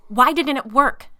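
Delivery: loud, high demand; woman demanding answers from her husband, loud. Acted. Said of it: "I demanded".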